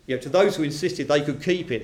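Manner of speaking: ordinary talk, nothing marked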